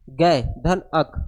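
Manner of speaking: slow split. Shown as a demonstration. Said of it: "क्या लिखे हैं?".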